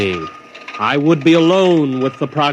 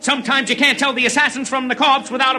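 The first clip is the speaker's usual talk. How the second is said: higher-pitched hawker crying his wares to the crowd; street vendor calling out; 8 semitones higher than usual. Acted. Vendor selling snacks to the ceremony crowd, calling out. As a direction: sing out the goods like a stadium vendor.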